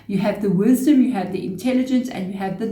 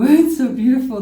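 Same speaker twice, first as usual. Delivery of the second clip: excited delight; 5 semitones higher, 7 dB louder than usual. Real, not acted. Moved by Sun and Star.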